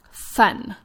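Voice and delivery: "fun" said as "thun", tongue between teeth; interdental lisp demo. Shown as a demonstration. Speech therapist showing parents an interdental lisp, lisping "fun". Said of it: "it would sound like".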